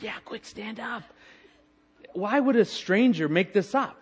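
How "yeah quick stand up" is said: hushed, high and urgent; retold whisper. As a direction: imitate a classmate's urgent prompting. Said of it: "and she whispers".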